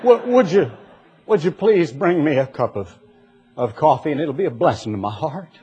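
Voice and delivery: soft, sugary holy voice; overly sanctimonious to the wife; husband sweetly asking wife for coffee, mock holy; mock pious husband. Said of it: "maybe they talk that way".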